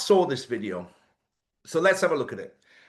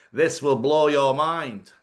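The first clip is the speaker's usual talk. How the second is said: slow, louder northern English monotone; imitating a northern English presenter; mock northern English accent; 5 dB louder, slower than usual. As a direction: drag it out in a flat northern English way. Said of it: "That accent is from the north of England".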